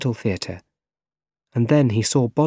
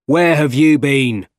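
raised, loud scolding; angry shout; 4 semitones higher, 5 dB louder than usual. A narrator's reading. Boss to photographer, shouting angrily. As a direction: bawl out a late employee. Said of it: "Jameson shouted".

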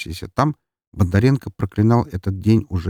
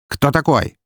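brusque, higher-pitched demand; sharp repeated question; 7 semitones higher, 3 dB louder than usual. A narrator's reading. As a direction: press him for his name. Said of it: "резко повторил он вопрос".

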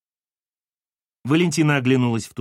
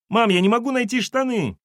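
put-on very high child's whining yell; falsetto shout; 11 semitones higher, 4 dB louder than usual. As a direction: complain to mum in falsetto. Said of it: "фальцетом закричал Тим".

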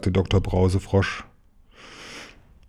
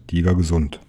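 usual speaking voice

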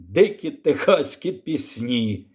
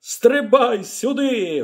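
drawn-out call, pitched up; 8 semitones higher, slower than usual. Acted. The Texan calls the girl into the basket, calling out. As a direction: shout it across the snow. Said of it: "гукнув техасець".

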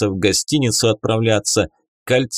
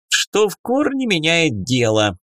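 snorting sarcasm; 7 semitones higher than usual. A narrator's reading. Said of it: "фыркнул казначей".

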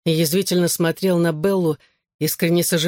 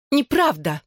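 higher-pitched objection; objecting; 7 semitones higher than usual. A narrator's reading. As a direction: protest in a raised pitch. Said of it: "возразила она". (возражая, повышенным тоном)

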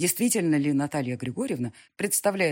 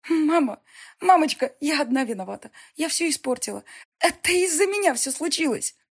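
higher, louder sobbing plea; choking on tears; 11 semitones higher, 6 dB louder than usual. Acted. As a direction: wail it through tears. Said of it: "захлёбываясь слезами".

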